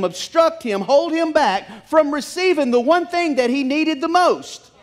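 fired-up preacher; preaching at full force. Acted. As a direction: drive the sermon home with rising force. Preacher builds the point.

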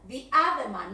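"the other man" with "the" as thee before the vowel; correct the other. Shown as a demonstration. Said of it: "not other man".

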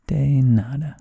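de nada loud, rising on de; teacher demo, loud. Shown as a demonstration. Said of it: "you would respond".

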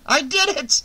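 high-pitched triumphant cry; exultant hoarse shout. A narrator's reading. Shelley exulting to Vera after the escape, exultant shout. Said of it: "he exulted with a hoarse shout".

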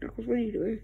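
normal talking voice

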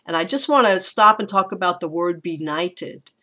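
usual speaking voice

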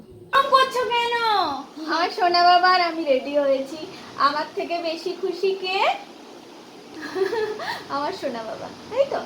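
put-on high sweet voice to a child; baby talk. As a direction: coo at your little son. Mother talking sweetly to child.

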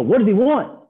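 high, loud called-out question; mock trader yelling across the desk; imitated impatient demand. Traders asking the newcomer about the caller, mock yell.

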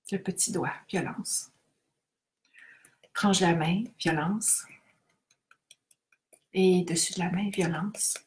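quiet, paced EFT tapping words; soft tapping recitation. Acted. Leader tapping with the group, soft and paced. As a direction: murmur each point gently.

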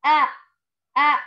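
a short clear أ said twice as a fresh open vowel; short a sound. Shown as a demonstration. Teacher models the sound for first grade: short أ. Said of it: "ينطق".

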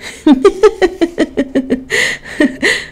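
long, helpless run of giggles; weak giggle. A narrator's reading. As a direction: giggle limply, trailing off. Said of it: "le entró una risa floja".

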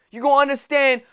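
normal talking voice

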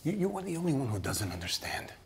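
hushed intense whisper. Acted. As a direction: drop to a bitter whisper. Husband turns quiet and intense.

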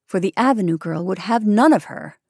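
usual speaking voice

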